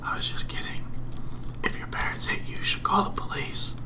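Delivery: hushed whisper, mom asleep nearby. Real, not acted. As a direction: whisper so nobody wakes. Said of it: "I'd probably yell about it right now, but I don't wanna wake my mom up".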